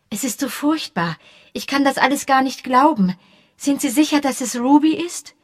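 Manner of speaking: a hint of a shaken young dancer; nervous young woman asking, lightly voiced; young woman, upset. Josie voiced in read novel.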